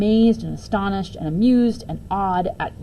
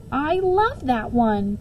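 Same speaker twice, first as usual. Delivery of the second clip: put-on slow, dreamy child; 5 semitones higher, much slower than usual. A narrator's reading. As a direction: draw it out like a kid in love. Child character speaking.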